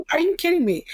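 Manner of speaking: high-pitched retold disbelief; incredulous. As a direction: push back in mock disbelief. Retold protest to unfair boss.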